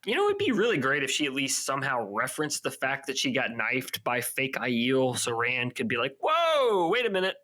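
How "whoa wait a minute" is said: startled protest. Pretend. Rand imagined denying the knifing.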